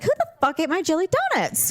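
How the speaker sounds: fast, high-pitched mock outrage; retold indignation. Sister retells missing donuts, indignant. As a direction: demand the culprit, half joking. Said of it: "'Cause I was pissed".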